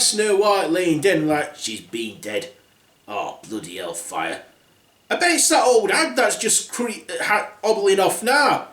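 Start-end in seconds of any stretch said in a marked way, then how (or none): 1.6-4.4 low dwarf. Acted. 5.1-8.7 excited dwarf. Acted. Dwarf spots hag.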